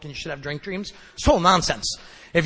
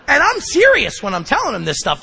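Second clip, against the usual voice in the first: usual speaking voice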